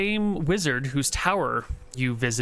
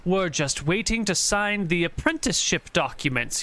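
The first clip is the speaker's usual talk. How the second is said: put-on higher, smooth wizard covering for his boy; pointed wizard; 7 semitones higher than usual. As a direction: say it pointedly, vouching for him. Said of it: "he says, looking at you pointedly".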